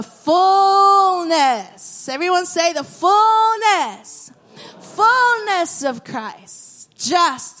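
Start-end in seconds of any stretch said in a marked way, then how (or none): 0.0-1.8 "fullness" long, high and loud; emphatic climax. Real, not acted. Preacher reaches the verse's climax, loud and drawn out. 2.7-6.9 "fullness" high and stretched slowly; emphatic call-out. Shown as a demonstration. Preacher has everyone say the word, high and drawn out.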